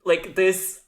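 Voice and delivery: ordinary talk, nothing marked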